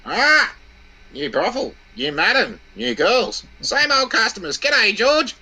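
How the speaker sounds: very high, loud bird screech; squawking parrot. Acted. Parrot spotting the husband coming home, high squawk. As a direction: squawk the greeting like a cheeky parrot. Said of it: "then the parrot arcs up with".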